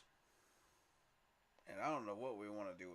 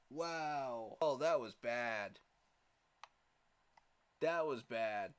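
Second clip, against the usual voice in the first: dismay; 6 dB louder, much slower than usual. Real, not acted. Reacting to lost pick.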